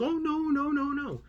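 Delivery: very high, drawn-out mocking denial; mock nice. Manager denying what she said earlier, mimicked high and mock-nice. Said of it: "they're so very nice".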